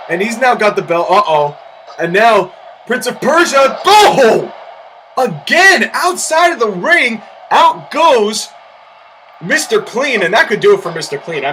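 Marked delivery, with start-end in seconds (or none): 2.9-8.5 excited shout. Real, not acted. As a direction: call the big spot. Excited call of a big spot.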